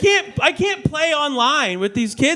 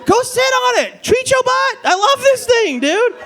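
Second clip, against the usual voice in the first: overexcited gush; 9 semitones higher than usual. Retold or imitated. Blurting out bidet love in small talk, high excited shout. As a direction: burst out with bidet joy. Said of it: "You can't be like".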